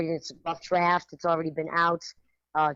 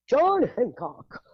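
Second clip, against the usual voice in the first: mock posh; 6 semitones higher, 5 dB louder, much slower than usual. Mocking posh Concord name.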